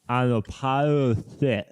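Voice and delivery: words mangled by a held tongue; holding tongue with fingers. Shown as a demonstration. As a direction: speak while gripping your tongue. What the fuck was that.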